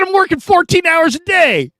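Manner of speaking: put-on young player objecting to the coach, high and outraged; indignant protest. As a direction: shriek it in disbelief.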